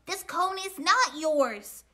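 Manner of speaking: squabbling squirrel's loud objection; indignant cry. A narrator's reading. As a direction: cry it out at a rival. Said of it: "cried Cyril".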